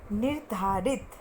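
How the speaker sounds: निर्धारित said slowly, every syllable clear; slow careful pronunciation. Shown as a demonstration. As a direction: spell out निर्धारित syllable by syllable for children to repeat. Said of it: "मेरे पीछे बोलने की कोशिश करना".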